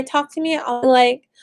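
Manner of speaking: ordinary talk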